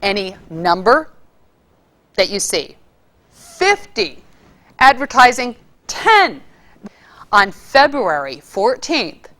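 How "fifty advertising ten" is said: swooping high on Fifty and ten; playful roller-coaster pitch; stretched voice. Shown as a demonstration. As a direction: let the voice ride up on each word. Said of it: "You can do this for any number".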